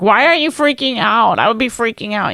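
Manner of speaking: high, loud mock alarm that fades at the end; mocking husband's panic. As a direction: parody his freak-out. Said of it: "You're like".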